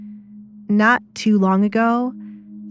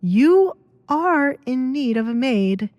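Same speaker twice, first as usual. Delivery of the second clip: character voice; 6 semitones higher, slower than usual. Acted. Desmond realizes the fact.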